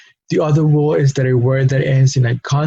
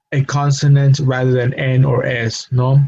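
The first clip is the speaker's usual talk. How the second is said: ordinary delivery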